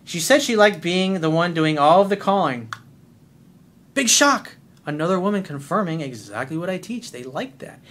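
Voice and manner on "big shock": mock surprise, higher and louder; sarcastic shock. Coach mocking the predictable news, sarcastically. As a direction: scoff at the obvious.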